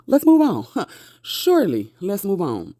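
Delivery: high and sing-song, mocking the judge; sassy mock agreement. Emerald mocks the judge's dismissal back, high sassy sing-song. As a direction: toss the judge's words back with sass.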